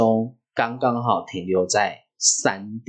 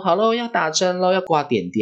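nurse; 8 semitones higher than usual. Retold or imitated. Nurse announcing an injection to child.

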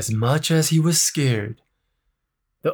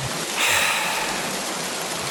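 long soft unvoiced sigh; big sigh; 6 dB quieter than usual. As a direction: let out a weary sigh. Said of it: "he gave a big sigh".

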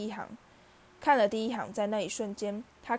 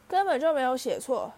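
higher and louder, indignant retort; angry; 4 semitones higher, 6 dB louder than usual. A narrator's reading. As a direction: fire it back in anger. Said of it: "哈利气愤地说". (哈利气愤地反驳)

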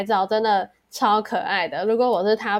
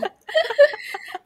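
high burst of laughter; 13 semitones higher than usual. Real, not acted. Recalling dad's selfie.

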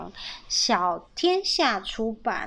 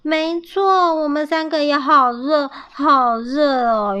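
louder, higher chorus-like agreement; eager agreeing; 4 semitones higher, 5 dB louder than usual. A narrator's reading. Three squirrels hot from running, eagerly agreeing. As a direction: agree emphatically, stretching the last words.

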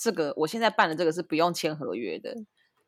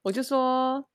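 usual speaking voice

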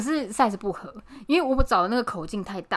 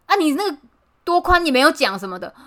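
mock angry shopkeeper; 6 semitones higher, 7 dB louder than usual. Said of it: "他就说".

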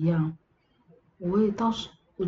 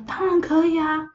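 lightly voiced little kid, higher; child's voice; 9 semitones higher than usual. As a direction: chirp it like a small child. Child invites aunt to eat. (轻轻模仿小孩的声音)

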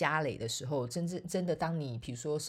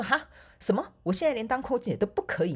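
higher, louder mock disbelief; mock outrage; 8 semitones higher, 5 dB louder than usual. Protesting to her mother, mock indignant. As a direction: play up your shock.

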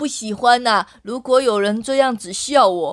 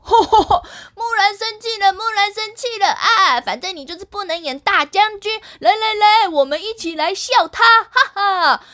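teasing laugh; 10 semitones higher than usual. Acted. Boys teasing Mulan.

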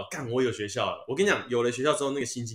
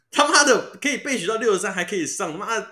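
high, loud incredulous outburst; excited disbelief; 7 semitones higher, 6 dB louder than usual. Real, not acted. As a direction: shout it in total disbelief. 得知备取上了激动大叫. (不敢置信地激动大喊)

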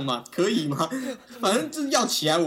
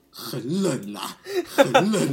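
whining cold; 4 dB louder, much slower than usual. Pretend. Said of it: "今年过年他妈是超冷".

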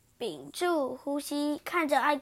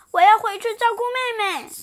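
put-on high, louder child voice; little antelope; 8 semitones higher, 9 dB louder than usual. Antelope excusing herself, high voice. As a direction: chirp it like a small antelope.

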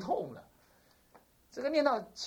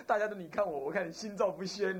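ordinary talk

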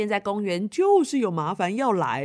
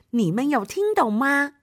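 usual speaking voice

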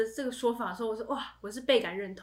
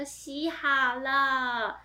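mock innocent reply; much slower than usual. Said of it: "然后我跟我姐说".

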